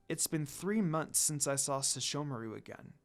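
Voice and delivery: soft, quiet inner voice, sad; brooding quietly to herself; sad thought. Acted. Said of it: "Kagome thought sadly".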